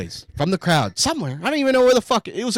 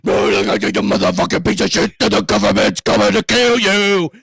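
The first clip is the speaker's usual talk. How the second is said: louder ranting heckler voice; 3 semitones higher, 6 dB louder than usual. Retold or imitated. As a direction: rant loudly like a crank. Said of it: "Fucking like".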